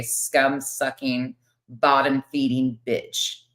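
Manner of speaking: slow, drawn-out contempt; scornful insult. Real, not acted. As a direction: stretch each word of the insult slowly. Insult delivered in an argument.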